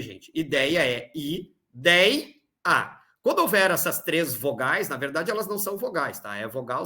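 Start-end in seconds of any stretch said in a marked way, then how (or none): 0.3-3.0 "i, dei, a" said as separate beats; syllable by syllable. Shown as a demonstration. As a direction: split "Ideia" into three clear syllables. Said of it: "não se separa daquele jeito ali". (separação silábica, sílaba por sílaba)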